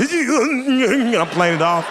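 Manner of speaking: high, gasping laugh while straining under a weight; strained breathless laugh. Retold or imitated. Gasping while carrying her.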